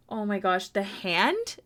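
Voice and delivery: normal talking voice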